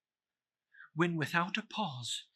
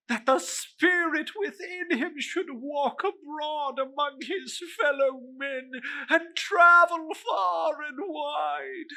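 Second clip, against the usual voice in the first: high, wailing lament; wailing ghost; 11 semitones higher than usual. Acted. Marley's ghost laments to Scrooge in a high wail. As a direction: moan like a doomed spirit.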